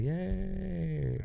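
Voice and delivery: high, soft mock cheer sound effect; a little canned yay; soundboard cheer. Shown as a demonstration. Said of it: "the little clap, like".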